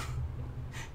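soft unvoiced laugh; quiet breathy chuckle. Real, not acted. Gamer chuckling softly over the dead enemy.

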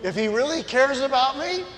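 loud, high preaching, shouting the point home; preaching at full force. Acted. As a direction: preach it big and loud, mocking the ad claims.

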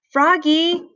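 teacher's high, drawn-out call. A narrator's reading. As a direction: call a pupil across a field. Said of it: "called his teacher".

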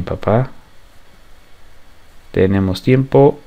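ordinary talk

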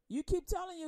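normal talking voice

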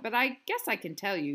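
normal talking voice

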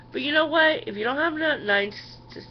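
boiling over mid-sentence; worked up. Real, not acted. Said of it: "I'm just getting all worked up".

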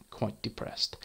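hushed deadpan whisper. Real, not acted. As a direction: whisper it under your breath. Mock sad aside.

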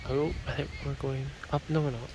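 usual speaking voice

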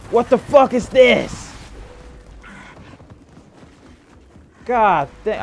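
outraged shriek at the screen; frustrated yelling. Real, not acted. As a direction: yell out in real frustration. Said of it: "I'm about to yell so loud".

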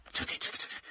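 whispered, very soft repetition; acted breathy hush; hushed wait-a-moment. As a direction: whisper it while an idea forms. Sudden idea, thinking to himself.

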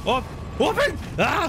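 high, frantic plea and cry; real panic; panicked cry. Real, not acted. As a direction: beg the door to open in real panic. Door won't open, monster near.

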